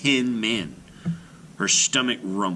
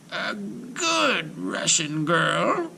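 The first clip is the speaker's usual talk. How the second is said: high, slow, sneering witch voice; disgusted witch; 9 semitones higher, much slower than usual. A narrator's reading. Witch Baba Yaga repeats it in disgust, slow and high. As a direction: recoil at the very idea. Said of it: "Baba Yaga shuddered".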